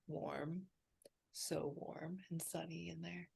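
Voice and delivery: very soft, contented near-whisper; savouring. Real, not acted. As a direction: murmur it softly, basking in the warmth. Savouring a warm feeling.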